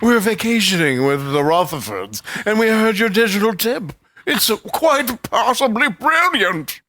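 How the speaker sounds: slow, posh British drawl; pompous British toff; British accent. Pretend. Co-host imagines a posh Hamptons listener, British accent. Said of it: "we're British".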